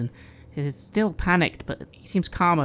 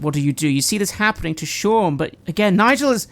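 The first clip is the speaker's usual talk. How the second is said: ordinary delivery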